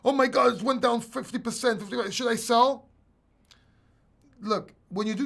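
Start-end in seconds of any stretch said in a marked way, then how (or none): 0.1-2.9 mock panic. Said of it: "And then".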